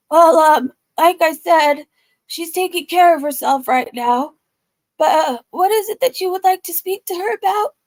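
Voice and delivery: husband voice. Retold or imitated. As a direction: mimic the nervous husband. Voicing husband stalling.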